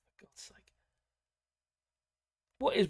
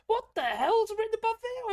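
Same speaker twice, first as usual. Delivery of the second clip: mock-astonished squeal of a question; startled disbelief. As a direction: blurt it out high, reliving the shock. Retells startled reaction to strapline.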